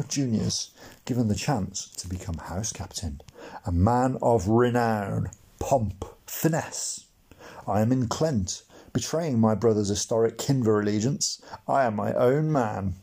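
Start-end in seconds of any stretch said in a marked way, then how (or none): none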